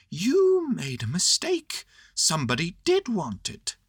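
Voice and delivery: solemn Pooh. A narrator's reading. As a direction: state it gravely in a high voice. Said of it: "said Pooh solemnly".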